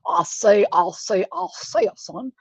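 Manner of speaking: Foghorn Leghorn. Retold or imitated. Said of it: "Foghorn Leghorn".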